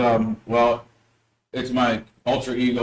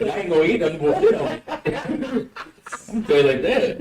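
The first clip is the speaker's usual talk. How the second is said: laughing; 8 semitones higher than usual. Real, not acted. Joking about sitting on ice cream.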